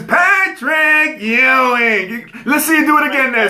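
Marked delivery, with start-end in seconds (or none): 0.1-2.1 "Patrick Ewing" drawn out slowly with weight; slow, emphatic "Patrick Ewing"; more emphasis. Shown as a demonstration. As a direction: stretch out "Patrick Ewing" and lean on it. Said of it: "you gotta put that emphasis".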